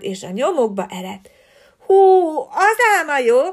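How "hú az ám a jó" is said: ordinary delivery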